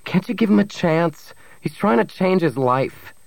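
high, pleading defence; crying out. A narrator's reading. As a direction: plead his case, pitch up. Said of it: "Bria cried".

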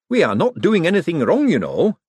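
high, eager nervous inventor; nervous economist boasting to visitors, high and eager; excitable Hubert. Acted. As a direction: gush about the machine, pitched high. Hubert defensive.

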